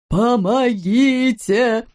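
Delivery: drawn-out high howl; wailed cry for help. A narrator's reading. Policeman shaken in a tree, wailing for help. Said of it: "завыл полицейский".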